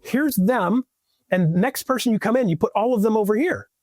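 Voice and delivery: high, slow, step-by-step explanation; explaining while drawing. Shown as a demonstration. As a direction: lay it out slowly like sketching on a whiteboard. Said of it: "all I gotta do is".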